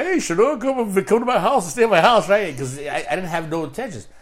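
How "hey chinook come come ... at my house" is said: higher and louder, eager welcome; uncles' warm invitation. Retold or imitated. Uncles inviting him to stay, eager and loud. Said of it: "my uncles were like".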